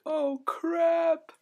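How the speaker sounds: very high, loud squeaky dismay; mock panic. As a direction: squeal it in exaggerated alarm. Retold reaction to being taken off.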